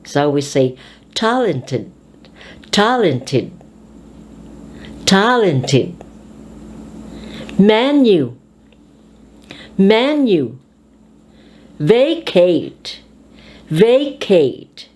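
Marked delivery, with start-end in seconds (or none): 1.1-6.0 "talented" with "tal" strong; stress on the first syllable. Shown as a demonstration. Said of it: "on the first syllable". 7.6-10.6 "Menu" with "men" strong; first-syllable stress. Shown as a demonstration. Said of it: "on the first syllable". 11.8-14.9 "Vacate" with "va" strong; first-syllable stress. Shown as a demonstration. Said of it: "on the first syllable".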